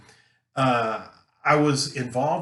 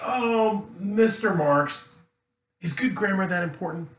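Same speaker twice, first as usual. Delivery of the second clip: doubting student; 5 semitones higher than usual. Pretend. Student questions teacher on whether grammar matters, mock doubting. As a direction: stretch the name out, then rise into the question. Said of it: "you think".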